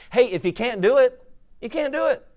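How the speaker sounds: high, loud, emphatic and offhand; preacher shrugging off what members can't afford; emphatic shrug. Acted. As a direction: toss it off with a big high shrug.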